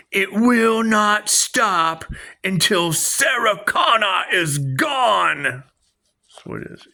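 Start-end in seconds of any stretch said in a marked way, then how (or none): 0.1-5.4 slow, high dramatic movie-line delivery, crying out at the end; hammed-up quote of the killer machine; Terminator quote. Quoting the Terminator film line.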